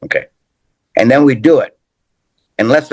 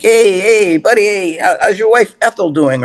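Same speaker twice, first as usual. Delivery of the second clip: calling out; 11 semitones higher than usual. Retold or imitated. Calling out to a passing clown.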